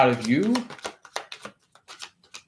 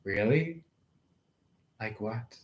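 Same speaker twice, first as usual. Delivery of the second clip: smirking; 5 semitones lower, 10 dB quieter than usual. Pretend. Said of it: "they might smirk".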